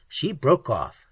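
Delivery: plain reading voice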